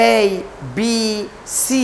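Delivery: long held vowels on এ, বি, সি; drawn-out letters. Shown as a demonstration. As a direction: stretch each of এ, বি, সি slowly. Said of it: "টান দিতে হবে".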